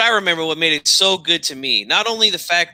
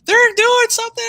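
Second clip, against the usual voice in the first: high, squeaky yelling manager voice; mock Jimmy Hart yelling; Jimmy Hart impression. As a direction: screech it like an annoying wrestling manager. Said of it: "Jimmy Hart's got that weird, annoying voice".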